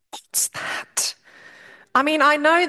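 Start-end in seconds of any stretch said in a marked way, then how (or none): 0.1-1.1 a sceptical unvoiced whisper; whispered aside. Real, not acted. Lecturer asks students about chemistry, whispered aside. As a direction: drop to a whisper, puzzled.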